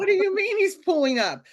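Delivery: high, loud frantic disbelief; a scared mother arguing with the dispatcher, frantic; panicked protest. Retold or imitated. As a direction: shout it in rising panic.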